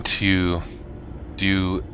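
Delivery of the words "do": ordinary talk, nothing marked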